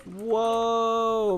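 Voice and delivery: a real jolt of surprise; startled whoa. Real, not acted. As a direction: caught off guard by the lag. Sudden internet lag.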